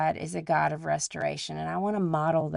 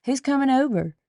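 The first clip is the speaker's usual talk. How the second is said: put-on much higher, louder kid voice; imitating her kids; 8 semitones higher, 5 dB louder than usual. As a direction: mimic kids asking, pitched way up. Voicing her kids' reply to cleaning.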